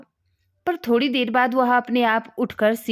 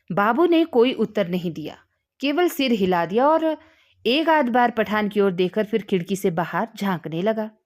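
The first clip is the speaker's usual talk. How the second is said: plain reading voice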